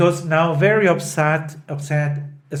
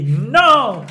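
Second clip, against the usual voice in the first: loud refusal; 7 semitones higher, 5 dB louder than usual. Retold or imitated. Santuzza refuses.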